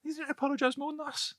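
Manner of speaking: high mock-offended challenge, pitched well up; playful mock indignation; mock indignant. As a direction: squeak it out like a playful accusation.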